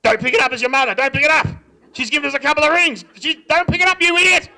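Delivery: loud, high-pitched exasperated dad shouting; irate dad bellowing orders; dad yelling. Acted. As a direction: yell it across the house in a panic. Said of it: "so Dad would be like this".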